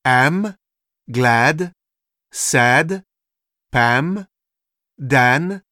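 "am, glad, sad, Pam, Dan" with a very short A; short English A. Shown as a demonstration. Teacher listing examples, very short A in "am, glad, sad, Pam, Dan". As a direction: separate words, each A like French "papa" but short. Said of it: "est très court".

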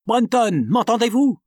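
captain shouting to Brunton over the noise; shouted command. Acted. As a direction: yell down to the engine room. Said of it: "s'écria Shandon".

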